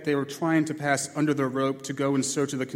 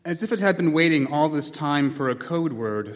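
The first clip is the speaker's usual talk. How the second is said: ordinary delivery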